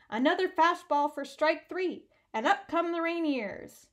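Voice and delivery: loud, excited play-by-play call; radio announcer. Acted. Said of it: "he heard Leo again".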